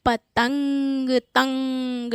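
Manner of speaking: पतंग slowly, then तंग alone with held nasal ng; nasal anusvar demo. Shown as a demonstration. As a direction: isolate the ringing तंग of पतंग. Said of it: "ये कैसे उच्चारित हो रहा है".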